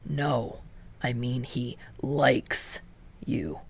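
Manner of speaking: slow, low, pressing on you; haughty. A narrator's reading. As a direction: lean down haughtily on the last word. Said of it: "his tone bending haughtily".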